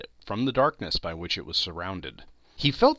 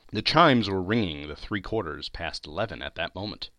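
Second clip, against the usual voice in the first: ordinary delivery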